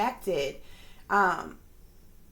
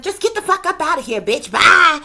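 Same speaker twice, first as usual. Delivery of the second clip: loud, high, fast mock outburst; sudden yell; 7 semitones higher, 12 dB louder than usual. Vlogger imitating a troll's sudden yell, loud and fast. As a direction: blurt it out loud and quick, much higher. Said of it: "and all of a sudden".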